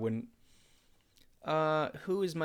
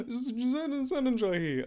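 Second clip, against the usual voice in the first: much higher, gasping with glee; flamboyant Zen gasp; 12 semitones higher, 4 dB louder than usual. Acted. Streamer gushing in Zen's voice about his ship. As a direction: swoon over the ship. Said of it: "Zen is flamboyant".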